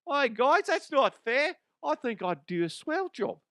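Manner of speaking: high, chirpy Aussie character voice. Acted. Aussie character protesting he'd be a swell president, in a high Aussie voice. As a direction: plead fairly like a cheery Australian.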